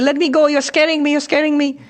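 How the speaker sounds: high, frightened lamb-like plea to be let go; a terrified cub pleading in a high bleat; bleating young lion. Acted. Young lion begs the big lion to release him, high and bleating. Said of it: "he started bleating".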